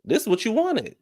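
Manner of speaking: high, squeaky "This is what you wanted"; high-pitched mocking rebuke. Vlogger chiding the crying contestant, high-pitched. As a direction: throw it at her in a high voice. Throws his words back at her.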